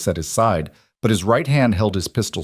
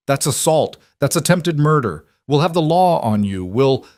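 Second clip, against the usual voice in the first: higher, panicky shrill outrage; rising shriek; 7 semitones higher than usual. A narrator's reading. Wounded Thomas threatening the law, higher outraged shriek. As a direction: screech your indignation at being shot. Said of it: "Thomas's voice rose to a shriek".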